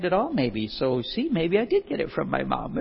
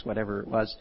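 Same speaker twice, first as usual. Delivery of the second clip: normal talking voice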